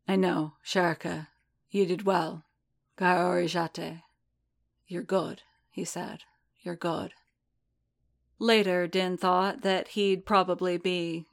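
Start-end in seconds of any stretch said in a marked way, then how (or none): 0.1-7.1 warm and fond. A narrator's reading. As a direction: hold him and soothe him. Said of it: "His voice was warm and deep and fond".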